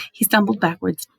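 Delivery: usual speaking voice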